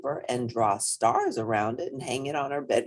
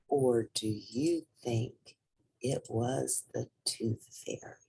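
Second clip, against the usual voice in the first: slow hushed storyteller; slower than usual. A narrator's reading. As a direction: end with gentle wonder.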